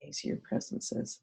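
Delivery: soft whispered aside; whispering. Acted. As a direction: breathe it out as a whisper. Representing, quiet reflection to Death.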